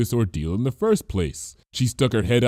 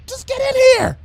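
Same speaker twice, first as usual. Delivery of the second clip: much higher and louder, desperate; pleading; 6 dB louder than usual. A narrator's reading. Bon Bon pleading with Lyra, desperate. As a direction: beg her to hurry. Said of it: "she pleaded".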